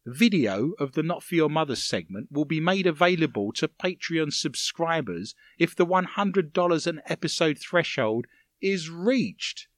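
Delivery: ordinary delivery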